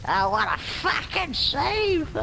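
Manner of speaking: drawn-out, very high-pitched cheer; excited exclamation. Real, not acted. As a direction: shriek it slowly at the amazing play. Reacting to a save in game.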